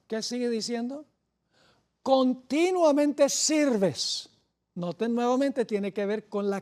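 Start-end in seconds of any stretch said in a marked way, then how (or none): 2.0-4.3 "Continuamente" drawn out very slowly and leaned on; slow each syllable of "Continuamente", then "sirves"; stress on continuamente. Shown as a demonstration. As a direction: land hard on "Continuamente". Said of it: "ahora escuchen bien".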